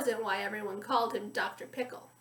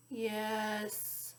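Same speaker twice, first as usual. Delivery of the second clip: a low, softer yes from somewhere deep down; low voice from deep inside; 3 semitones lower, 7 dB quieter than usual. A narrator's reading. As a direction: answer quietly, as if from deep inside, under hypnosis. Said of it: "in a low voice from deep inside her".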